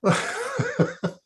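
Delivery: real high giggle at own joke; amused laughing, high and light; high-pitched laugh. Real, not acted.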